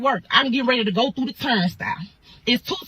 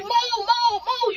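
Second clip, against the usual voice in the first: high urgent orders; urgent command; 11 semitones higher, much slower than usual. Retold or imitated. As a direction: shoo them off, urgently. Ordering friends aside in fight.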